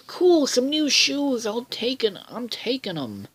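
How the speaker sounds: Milo voice. Retold or imitated. Milo picks up the thrown shoes, in a very high goofy kid voice. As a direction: pitch way up and draw out "Cool" like a delighted kid. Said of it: "and they're like".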